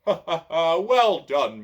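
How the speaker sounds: loud hearty laughing; booming laugh. A narrator's reading. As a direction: laugh it out big. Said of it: "laughs a great booming laugh".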